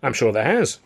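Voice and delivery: usual speaking voice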